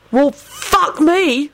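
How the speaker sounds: high, drawn-out disbelief; incredulous bark. A narrator's reading. Sirius answers the screaming woman, barked incredulity. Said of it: "barked out incredulously".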